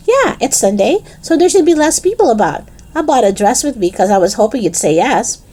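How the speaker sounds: put-on high, quick-starting girlish persuasion; eager Lisa. Voices Lisa persuading Dennis.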